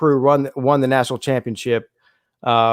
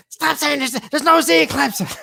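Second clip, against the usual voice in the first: much higher-pitched exasperated wife impression; imitated freak-out; 11 semitones higher than usual. Host imitates wife cringing at announcers, mock freaking out. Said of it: "She starts freaking out".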